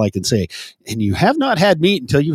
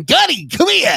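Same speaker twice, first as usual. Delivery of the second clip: high hammed-up summons; mock calling out; 11 semitones higher than usual. Co-host pretending to call Gunny over, high mock call.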